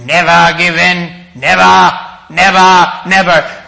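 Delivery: hammed-up statesman's oratory, forceful; Winston Churchill. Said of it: "Impersonation, terrible".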